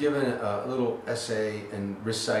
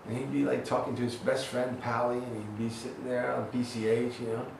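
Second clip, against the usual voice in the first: usual speaking voice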